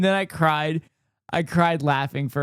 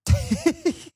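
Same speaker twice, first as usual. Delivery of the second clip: squeaky high-pitched laugh; a burst of high giggling; high giggle. Real, not acted. Reacting to own joke.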